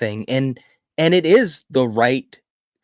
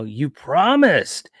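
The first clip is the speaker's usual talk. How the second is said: slow, higher and louder "You promised"; mock angry accusation; 5 semitones higher, 4 dB louder, much slower than usual. Wife accusing husband of breaking his vow, slow mock yell. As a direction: drag out the accusation like a mock scold. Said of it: "she starts yelling at him".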